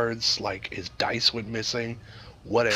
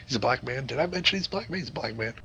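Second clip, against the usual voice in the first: ordinary talk, nothing marked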